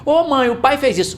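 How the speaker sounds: put-on high childish complaint; imitating a tattling kid. Kid voiced by father, tattling to mom in a high voice. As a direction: whine it up like a little kid telling on dad.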